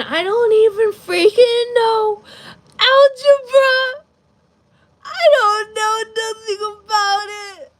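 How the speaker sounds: high, loud exasperated cry; hammed-up despairing shout; frustrated wail. Real, not acted. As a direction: wail over the algebra. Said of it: "I'm gonna literally cry".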